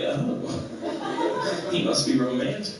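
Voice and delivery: low, softened mock-smitten murmur; mock swooning. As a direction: act lightly charmed by a foreign accent. Listener swooning at a French accent.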